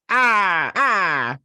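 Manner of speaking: high, loud little yelps; playful zap cry. Real, not acted. Fan reacting to a character zapping out, loud high yelps. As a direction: yelp like getting zapped.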